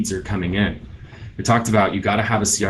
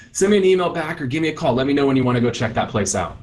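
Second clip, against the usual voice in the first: higher-pitched, upbeat realtor greeting a lead; friendly video message; 4 semitones higher than usual. Shown as a demonstration. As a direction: open high on the greeting, then settle into an easy pitch. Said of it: "you just shoot a simple message".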